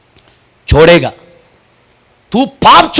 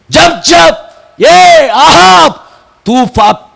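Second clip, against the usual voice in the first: high, loud, stretched preacher's cry; full-force preaching; 10 semitones higher, 5 dB louder than usual. Acted. Preacher rousing the church about sin, full force. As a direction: thunder it at the congregation. Preaching God's covenant with Phinehas.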